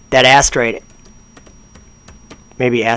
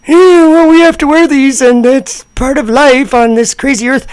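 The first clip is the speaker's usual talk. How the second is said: mock forced cheerfulness; 11 semitones higher than usual. Mocking the presenter's forced cheer in a high voice. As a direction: fake a bright smile over the annoyance. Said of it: "she has to act like".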